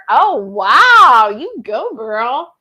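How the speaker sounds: ordinary delivery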